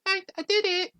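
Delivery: very high, squeaky little voice; tiny high-pitched character voice; eager waitress. Acted. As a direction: pipe up proudly in falsetto. Game master voices eager waitress.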